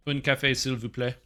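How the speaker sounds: polite Quebec French "s'il vous plaît"; a hint of Quebec French, polite; Quebec French accent. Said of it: "And it's an accent?".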